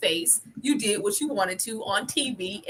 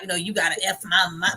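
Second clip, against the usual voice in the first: usual speaking voice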